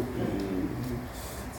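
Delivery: lightly imitated warning growl; dog's low growl. German Shepherd growling at a small friendly visitor, low mock growl. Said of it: "Strider going".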